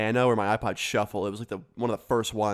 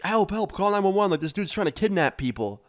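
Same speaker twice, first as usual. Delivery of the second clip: higher, urgent call for help; retold panic; 7 semitones higher than usual. Kids beg the store clerk to call nine one one, higher and urgent. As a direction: burst in begging for help.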